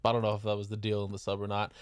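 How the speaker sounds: ordinary delivery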